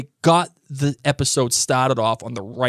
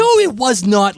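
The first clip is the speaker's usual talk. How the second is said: higher-pitched, insistent objection; emphatic protest; 8 semitones higher, 5 dB louder than usual. Real, not acted. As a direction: flatly contradict your co-host, voice raised. Arguing against co-host's suggestion.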